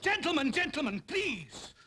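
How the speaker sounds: high, urgent call, falling away; calling to order. Acted. Judge interrupting two squabbling officers, calling for order. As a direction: cut across the quarrel, pleading for order.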